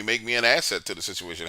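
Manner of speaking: ordinary delivery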